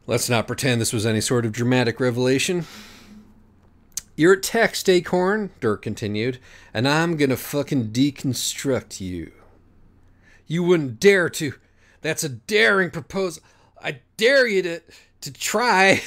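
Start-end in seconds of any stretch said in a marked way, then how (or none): 4.2-9.3 raised, cocky taunt; smug hipster voice. Acted. Dirk's voice. 10.5-16.0 high, halting bluster; faltering. Acted. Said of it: "Acorn said falteringly".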